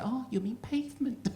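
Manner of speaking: high, prissy correction of a word; mocking Brit correction. Comic imitating a British correction, high and prissy. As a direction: correct him like a smug Brit. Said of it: "and say".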